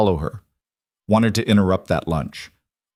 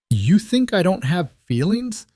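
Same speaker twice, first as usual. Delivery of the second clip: much higher, rising in anger; raised voice; 10 semitones higher than usual. A narrator's reading. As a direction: let the voice climb. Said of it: "Adrian's voice rose".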